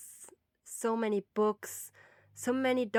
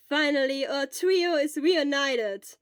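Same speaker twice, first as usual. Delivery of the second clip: happy; 6 semitones higher, 9 dB louder, slower than usual. Acted. Happy reunion exclaimed.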